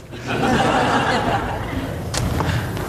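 airy high burst of laughter; breathy amused laugh; real laughter. Real, not acted. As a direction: laugh out at the memory. Laughs recalling the shouting match.

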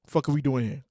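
ordinary talk

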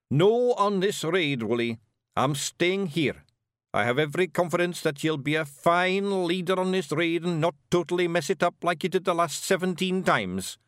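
Rob Anybody. Acted. Rob appointing Wullie raid leader, firmly. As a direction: lay down the decision firmly.

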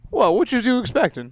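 lightly put-on voice for an imagined Colonel; voiced Colonel Sanders. Imagined actor playing the Colonel answers viewers, comic character voice.

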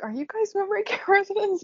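high, laughing disbelief; incredulous mock question. Wondering aloud about the forgetful staff, high and laughing.

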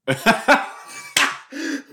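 loud, high burst of laughter; laughing. Real, not acted. Viewer cracking up at a face on screen, loud high laugh.

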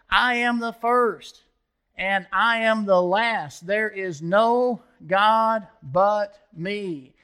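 loud, high, slow proclamation of God's words; proclaiming. Acted. Preacher reading Isaiah to congregation, loud proclamation. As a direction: ring it out as God's own voice.

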